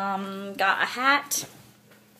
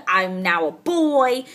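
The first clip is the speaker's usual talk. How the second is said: ordinary delivery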